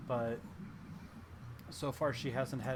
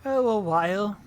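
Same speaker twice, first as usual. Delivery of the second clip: put-on scatterbrained old hag voice; erratic old frog hag; 4 semitones higher, 10 dB louder than usual. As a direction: play the hag, erratic and chatty.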